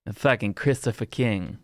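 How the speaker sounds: put-on Boston accent; playful Boston tough-talk accent; full Bostonian. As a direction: talk like a Bostonian, r's dropped. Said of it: "Or go full Bostonian".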